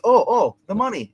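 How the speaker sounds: high, loud sudden oh at the money; imitating a startled, eager grab for the money; mock surprised oh. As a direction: act like you just spotted the cash. Grace voiced grabbing the cash.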